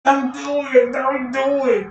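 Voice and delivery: loud high pleading warning; mock warning. A dream voice pleading loudly not to. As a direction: beg them not to, half-joking.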